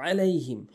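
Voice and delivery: আলাইহিম with a squeezed throaty onset; correct ain. Shown as a demonstration. Teacher repeating the correction, throaty আলাইহিম. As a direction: press the ain at the start of আলাইহিম. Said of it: "আলাইহিম বলতে হবে".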